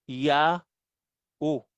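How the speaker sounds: "Ya, U" split by a clear pause; syllable-by-syllable reading. Shown as a demonstration. Teacher sounds out the letters, "Ya, U" as two separate syllables. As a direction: say "Ya", pause, then "U" as oo. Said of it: "Ano yung bigkas doon".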